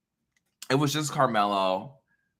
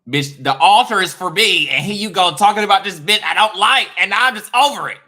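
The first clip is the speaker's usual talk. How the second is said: loud, higher, indignant diva voice; annoyed orisha; 8 semitones higher, 11 dB louder than usual. Pretend. Oya annoyed at Oshun's name, loud and indignant. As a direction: play the offended goddess, loud. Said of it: "it's just gonna be like".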